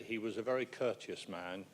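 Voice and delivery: ordinary delivery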